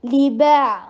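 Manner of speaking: لی با with a clear ee in لی; li not le. Shown as a demonstration. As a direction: say لی با with ee, never لے. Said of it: "عین کے اوپر توسط کا ٹائم دیجیے". (لی با، لے نہیں)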